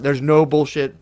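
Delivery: normal talking voice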